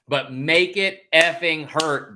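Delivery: "effing hurt" drawn out slow and loud; fired up. Real, not acted. Host urging Bama on, fired up, "effing hurt" stretched out slowly. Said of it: "I'm getting fired up".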